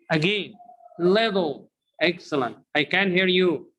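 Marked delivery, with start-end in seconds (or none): 1.0-1.7 little said as liddle; American flap t. Shown as a demonstration. Teacher drilling learners, d sound in little. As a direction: turn the tt in little into a d. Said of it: "Repeat after me".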